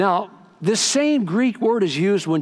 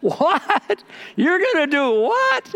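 much higher, disbelieving What? What?; stunned disbelief; 12 semitones higher, slower than usual. Retold or imitated. Surprised listener, offered a free mansion, stunned disbelief. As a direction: act floored by the offer, slowing on the last line. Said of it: "No, you go".

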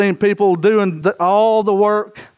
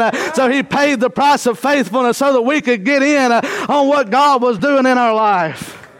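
rising, fervent preacher voice, higher; preaching; 4 semitones higher than usual. Acted. Preacher builds to full force.

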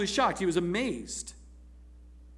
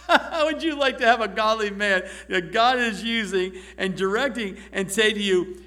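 normal talking voice

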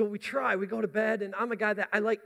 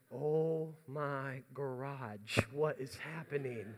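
dismayed disbelief; 4 semitones lower, 8 dB quieter, much slower than usual. Retold or imitated. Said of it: "And I was like".

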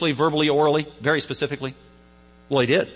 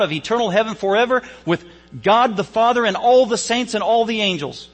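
high, loud, soaring sermon delivery; full-force preaching; 5 semitones higher, 4 dB louder than usual. Acted. Preacher voicing Jesus' promise to the thief, full-force preaching. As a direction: preach it out with rising fervour.

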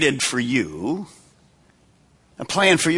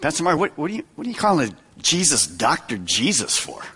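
puzzled churchgoer; 5 semitones lower, 4 dB quieter, faster than usual. Retold or imitated. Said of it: "sometimes we're".

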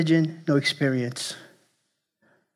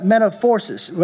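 usual speaking voice

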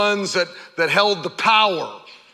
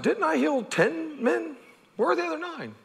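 rhetorical question; 10 semitones higher, 6 dB quieter than usual. Retold or imitated. Jesus asking rhetorically.

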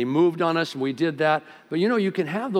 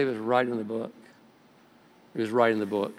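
calm divine rebuttal; 5 semitones lower than usual. Acted. God's calm answer to the excuse.

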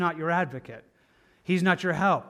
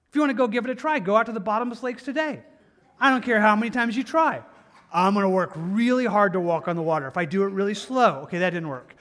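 higher, louder preacher pushing the point; full-force preaching; 5 semitones higher, 6 dB louder than usual. Acted. Pastor mocking trying to walk on water, preached loud. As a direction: drive it home from the pulpit.

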